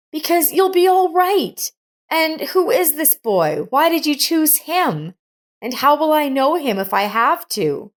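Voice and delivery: put-on anxious, hopeful young voice; young woman, worried. A narrator's reading. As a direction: insist she'll be all right. Monica's voice, anxious.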